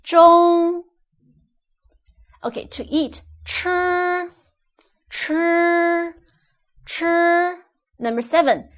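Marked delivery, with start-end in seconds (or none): 0.0-0.8 中 loud, high and perfectly level; flat, high pitched. Shown as a demonstration. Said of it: "flat and high pitched". 3.4-7.6 吃 drawn out high and level; high first tone. Shown as a demonstration. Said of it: "First tone is high-pitched".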